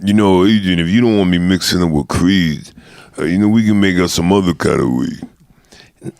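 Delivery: a hint of mumbling boxer; lightly voiced Rocky. Quoting Rocky scene.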